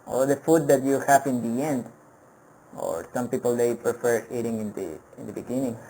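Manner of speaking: ordinary talk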